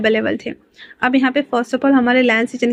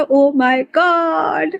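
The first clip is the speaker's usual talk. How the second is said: crowd screaming; 5 semitones higher, much slower than usual. Retold or imitated. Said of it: "सारे लोग तो चिल्ला रहे थे".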